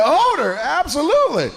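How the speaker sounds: high, laughing imitation of the old lady; lightly voiced witchy old woman, laughing; 1 mock old lady. Comic telling the crowd what the lady said, in a high mock voice. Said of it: "She said".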